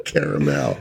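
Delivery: low "caramel" as CARA-MEL; "caramel" dropped low, full "mel" ending; Cara plus Mel. Shown as a demonstration. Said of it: "Put 'em together".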